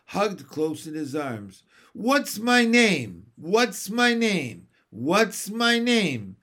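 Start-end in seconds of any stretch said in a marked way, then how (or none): 1.9-6.3 high, slow pleading question; starling anxiously pleading with baby John; shrill and anxious. A narrator's reading. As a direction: beg anxiously to be known. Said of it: "in a shrill, anxious voice".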